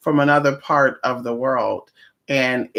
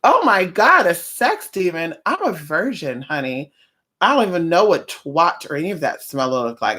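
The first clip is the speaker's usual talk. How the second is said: mock shocked innocence. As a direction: play it off like a clutching-pearls prude. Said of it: "And they'll play it off".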